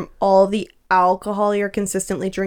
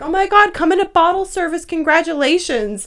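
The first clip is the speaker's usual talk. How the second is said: mock eager men; 8 semitones higher than usual. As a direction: ham up the men inviting them in. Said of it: "the men in Vegas are like".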